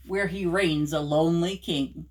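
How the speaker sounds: usual speaking voice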